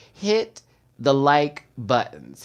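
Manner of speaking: slow, broken-up HIT THE LIKE BUTTONS; drawn-out request. Real, not acted. Host asks viewers for a favor, drawn-out request. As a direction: stretch out HIT THE LIKE BUTTONS with pauses between words.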